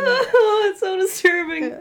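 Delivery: high squealing laugh; cracking up mid-word. Real, not acted. Laughing at hard hands.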